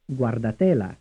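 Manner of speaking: "Guardatela" with the beat on TE; third-syllable stress. Shown as a demonstration. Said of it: "stressed on the third syllable".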